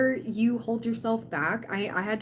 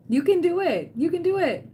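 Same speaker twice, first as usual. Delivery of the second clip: higher and louder, rallying cry; cheering encouragement; 8 semitones higher, 5 dB louder than usual. Real, not acted. Streamer rallying a nervous viewer, excited cheer. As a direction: pump up your viewer.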